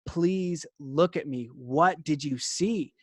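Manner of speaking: slow, deliberate asking; slow earnest plea. Retold or imitated. Man slowly begging the girl to look at him. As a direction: spell it out slowly to calm her.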